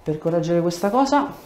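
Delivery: ordinary talk, nothing marked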